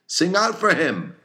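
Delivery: high, loud chorus shout; crew shouting together. A narrator's reading. Sailors answer their captain in a high, loud shout. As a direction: bellow it like a dozen sailors at once. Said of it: "impulsive rejoinder from a score of clubbed voices".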